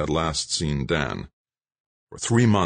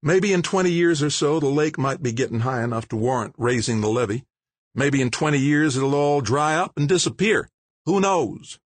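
higher, dismissive and sarcastic; mayor's voice; 9 semitones higher than usual. Acted. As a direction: brush the worry off mockingly. Mayor mocks the concern.

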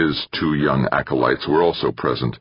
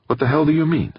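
much higher, angry challenge; indignant outburst; 12 semitones higher, faster than usual. A narrator's reading. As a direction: fire it back, offended. Molina angered by accusation.

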